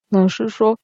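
usual speaking voice

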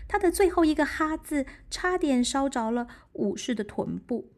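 ordinary delivery, nothing marked

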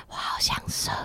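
breathy whisper, no voice. Retold or imitated. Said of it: "我就用气音说". (用气音说)

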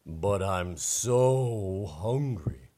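slow, lightly voiced hungry bear.

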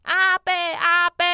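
高声大喊阿伯; shouting. Acted. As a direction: call out loud to wake him. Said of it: "店大喊". (弟弟大声喊醒阿伯)

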